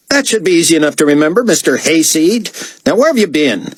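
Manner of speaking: high, peevish diva voice; sassy artiste, dismissive. A narrator's reading. Artiste mocking David's name, peevish and demanding. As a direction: scold in a high, fussy voice.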